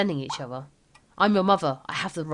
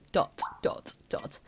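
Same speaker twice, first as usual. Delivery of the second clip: whispered dot dot dot; 8 dB quieter, slower than usual. Acted. Spoken trailing silence gag.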